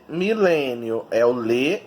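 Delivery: "milênio" slowly called out, LÊ stretched; stress on LÊ. Shown as a demonstration. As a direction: draw out the LÊ in "milênio". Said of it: "descobrir qual é a sílaba tônica".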